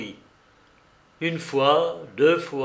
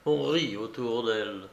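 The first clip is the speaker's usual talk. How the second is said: ordinary delivery, nothing marked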